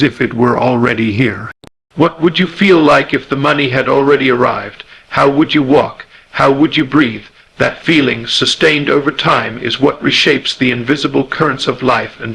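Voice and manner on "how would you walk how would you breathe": normal talking voice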